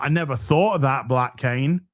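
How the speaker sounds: ordinary delivery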